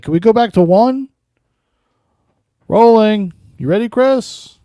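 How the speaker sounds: high, loud director calling the take; hammed-up director barking rolling; mock film director. Streamer mocks the director restarting Chris's video take, loud and high. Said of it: "He's like".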